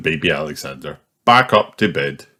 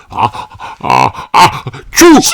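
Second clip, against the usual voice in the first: building sneeze, bursting loud; sneezing; 6 semitones higher, 6 dB louder, much slower than usual. A narrator's reading. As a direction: wind up into a sneeze. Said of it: "Daddy Pig sneezes".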